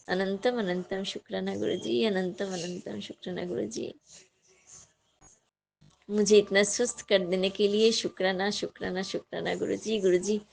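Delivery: soft, hushed thanks to the guru; devotional prayer. Real, not acted. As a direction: murmur gratitude like a prayer. (धीमी आवाज़ में भक्तिपूर्ण प्रार्थना)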